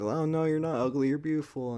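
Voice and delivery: mock reassurance. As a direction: gush like a cheesy friend.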